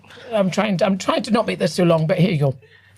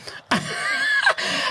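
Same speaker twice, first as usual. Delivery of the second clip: very high-pitched squeal of laughter; 4 dB quieter than usual. Real, not acted. Storyteller cracks up at own fruit story, squealing laugh. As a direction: burst into a real high squeal.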